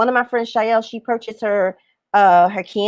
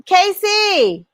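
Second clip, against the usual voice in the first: raised, higher-pitched call for someone; 7 semitones higher than usual. Real, not acted. Host calling for Casey, calling out. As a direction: call out the name to summon them.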